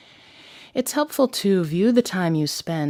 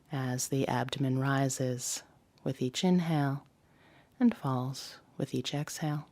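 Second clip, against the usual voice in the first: guided meditation. Acted. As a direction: ease the listener into the body scan gently. Leads the body scan.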